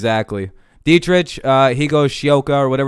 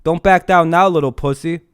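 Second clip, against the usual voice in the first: raised, goading taunt at a troll; taunting yell; 5 semitones higher than usual. Real, not acted.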